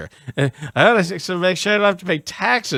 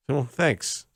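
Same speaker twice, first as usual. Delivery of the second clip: normal talking voice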